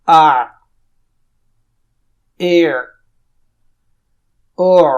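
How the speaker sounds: aa, e, o pushed hard from the mid throat; strained throaty drill sounds; drill of ain vowels. Shown as a demonstration. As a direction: press the throat on each vowel. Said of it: "عین کی مشق اور practice".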